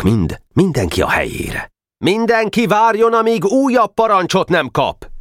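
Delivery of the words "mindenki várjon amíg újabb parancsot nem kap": magasan, messzire kiáltott parancs; kiáltsd a távolodó fiúk után; utánuk kiált. Acted. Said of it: "Kiáltott utánuk Boka". (hangos vezényszó)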